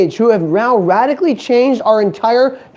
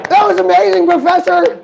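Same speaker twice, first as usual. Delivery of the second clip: mock gushing; 9 semitones higher than usual. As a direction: gush sarcastically over the professor. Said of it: "you're like".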